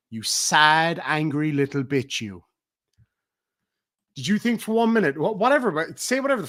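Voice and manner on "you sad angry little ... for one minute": ordinary talk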